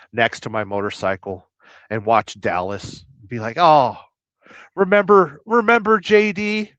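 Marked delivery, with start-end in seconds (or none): 3.6-6.7 higher, louder playful "remember when"; mock reminiscing. Rider on the couch reminiscing with his motorcycle, mock excited. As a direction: reminisce aloud, pitched up and punchy.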